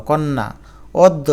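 কন্যা, অদ্য as "kon-na, od-do"; doubled consonant. Shown as a demonstration. Teacher showing doubling, অদ্য as "od-do". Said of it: "দ দুইবার হয়ে গেল".

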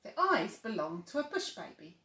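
ordinary delivery

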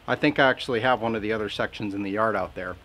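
ordinary delivery

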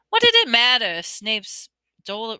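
ordinary delivery

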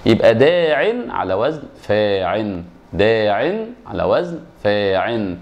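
slow "داع" and "فاعل" as daa-'in, faa-'in; nunated endings. Shown as a demonstration. Teacher matches word to pattern, slow nunated endings. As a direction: draw out both words, each ending in -in. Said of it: "حذفنا اللام من الوزن قصاد الياء المحذوفة".

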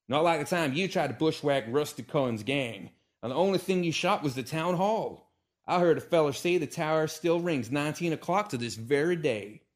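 high, loud screamed taunt; Calamity screams back at Hiccup before the staring town; screaming. Acted. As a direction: scream the jibe nose to nose. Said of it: "screaming in each other's faces".

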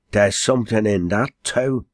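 ordinary delivery, nothing marked

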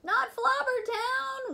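high, loud, slow cry of dismay; horrified shout. Acted. Children shouting in horror at the teacher's news. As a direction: shout it like the worst news ever. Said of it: "we shouted".

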